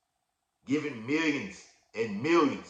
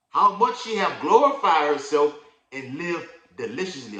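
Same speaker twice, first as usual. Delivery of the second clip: loud, raised scripture reading; preaching scripture; 4 semitones higher, 8 dB louder than usual. Acted. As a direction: proclaim the verse to the congregation. Reads scripture aloud, preaching.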